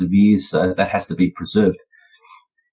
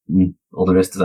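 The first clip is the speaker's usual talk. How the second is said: ordinary talk, nothing marked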